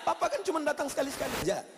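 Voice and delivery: a wife scolding her husband, building to shouts; complaining wife. Acted. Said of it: "Lalu ibu komplain".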